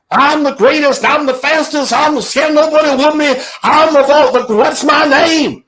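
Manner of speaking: high, loud champ boasting to the room; Ali. Retold or imitated. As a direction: belt out the boasts like a showman. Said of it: "Ali's shaking a lot of hands".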